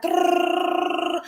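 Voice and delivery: rolled-tongue machine gun rattle, high; gunfire noise. Retold or imitated. Imitating the rifle fire in the dream, rolled rattle. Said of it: "she just started shooting, like".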